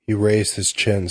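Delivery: ordinary delivery, nothing marked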